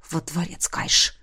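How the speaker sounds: hissed low through clenched teeth; through gritted teeth. Acted. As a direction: bite the words out quietly. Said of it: "процедил кронпринц".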